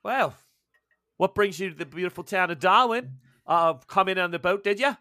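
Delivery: friendly Aussie question, lightly voiced; Aussie barmaid. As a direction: barmaid asking Jack why he came, Australian accent. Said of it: "and she's like".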